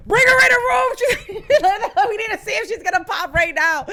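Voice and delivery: high, loud imitated staff alarm; urgent shouting. Staff rushing her into a room, urgent shouting. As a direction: call out urgently across the ward, half laughing.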